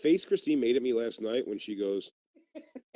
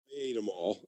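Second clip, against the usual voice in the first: softer, understated retold despair; mock despair; 3 semitones lower, 7 dB quieter than usual. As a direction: imitate a guilty, despairing confession, voice sinking. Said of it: "despair when I said to her".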